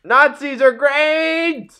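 long, high triumphant yell; full-throated crowd-silencing bellow; top-of-his-lungs shout. Acted. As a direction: holler it out, drawn out and high. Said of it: "at the top of his lungs".

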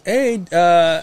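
high-voiced man impression; imitating a high-pitched caller; Jerry. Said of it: "Doesn't he have the high voice?".

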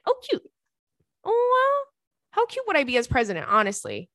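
high delighted squeal. Real, not acted. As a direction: squeal at the compliment. Delighted by fan email.

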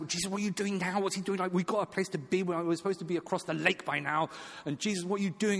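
high, put-upon voice of a flustered disciple; exasperated disciple. Retold or imitated. Disciples fretting at Jesus over delays, high exasperated whine. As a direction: whine it like an impatient follower. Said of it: "he'd be like".

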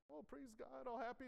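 high, light imitation of happy worshippers; mock happy. Preacher imitates the happy churchgoers praising God, mock happy. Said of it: "they're all happy".

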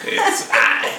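low, slow formal greeting; mock romantic suitor. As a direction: draw it out like a hammed-up suitor. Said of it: "Was it very romantic what you said".

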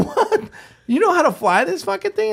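very high, incredulous squeal; mock alarm. Passenger questioning a young pilot, mock alarm. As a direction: question it in a panicky falsetto.

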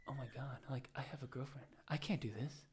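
a quiet alarmed aside to himself; hushed panic. Retold or imitated. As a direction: mutter it to yourself in sudden guilt. Dream guilt, talking himself out.

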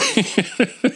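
high-pitched burst of giggles. Real, not acted. Laughing at her own bad boy joke, high giggle.

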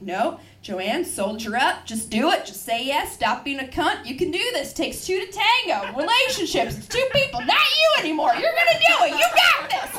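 firm start building to a high shout; frantic self pep talk. Acted. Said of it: "It's like the internal monologue".

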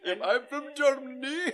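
high, loud, slow mock German voice through laughter; hammed-up German accent. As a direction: ham up a cartoon German officer. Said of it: "far laugh cry, redo after "that wasn't German".

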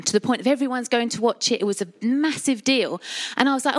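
normal talking voice

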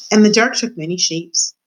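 ordinary delivery

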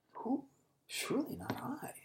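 anxious breathy whisper, high; whispered worry. Acted. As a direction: whisper it, afraid it's you. Disciples anxiously asking, whispered.

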